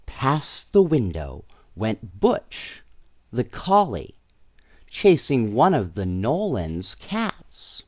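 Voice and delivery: put-on lively read-aloud voice for children; storybook reading; story narration. A narrator's reading. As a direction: tell it like a bedtime storybook.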